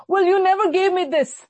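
high, loud, whiny complaint to a parent; accusing child. Retold or imitated. Child accusing parents who never gave them this, in a high whiny voice. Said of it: "some people who talk like this".

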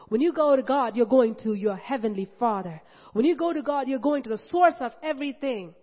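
loud rising sermon build; preacher hammering a refrain to the congregation; preaching at full force. Real, not acted. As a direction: drive each repetition harder. Repeated preaching climax.

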